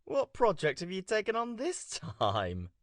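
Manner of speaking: phone voice. Real, not acted. Coop talking over phone call.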